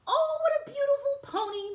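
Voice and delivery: high fluttery fairy delight; delighted exclaim. Acted. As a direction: coo over the pony in delight. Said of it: "Merryweather exclaimed".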